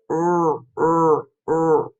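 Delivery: repeated rrr sound. Shown as a demonstration. Teacher asks kids to make the R sound. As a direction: model the R sound again. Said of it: "Can you make it?".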